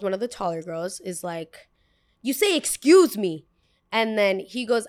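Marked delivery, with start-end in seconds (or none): 2.2-3.5 loud, higher, scolding; indignant demand. Retold or imitated. Tall girl demands politeness.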